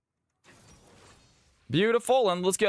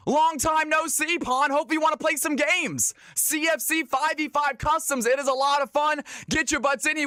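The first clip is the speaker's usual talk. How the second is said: excited greeting; 6 semitones higher than usual. Real, not acted. Greeting returning player hyped.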